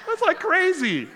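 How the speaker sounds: high, loud laughing disbelief. Real, not acted. Preacher laughing at his own traffic-ticket story, high and loud.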